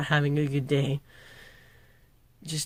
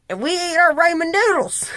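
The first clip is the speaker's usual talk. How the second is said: mock northern pride; 13 semitones higher, 6 dB louder than usual.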